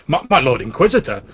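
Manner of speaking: proud servant voice. Acted. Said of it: "puffs his chest out of it and says".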